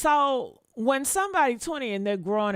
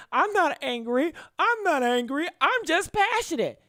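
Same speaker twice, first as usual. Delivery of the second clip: mocking excuse; 7 semitones higher than usual. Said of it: "because they".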